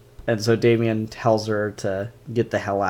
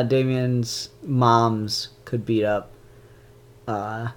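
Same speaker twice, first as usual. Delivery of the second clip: ordinary talk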